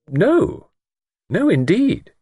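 high-pitched, protesting lady; unconvincing denial. A narrator's reading. Miss Wainfleet denying to Luke any suspicion, not quite true. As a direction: deny it a little too much, high. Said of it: "had not rung quite true".

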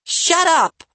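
very high, loud shriek; angry shout. A narrator's reading. Livy shouts down teasing Bradley, furious and high. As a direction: shout him down shrilly. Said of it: "Livy shouted".

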